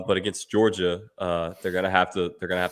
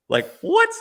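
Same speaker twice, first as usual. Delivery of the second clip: surprised; 8 dB louder than usual. Real, not acted. Reacting to Perkins not knowing MJ.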